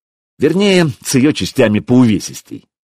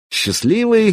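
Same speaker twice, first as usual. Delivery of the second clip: ordinary delivery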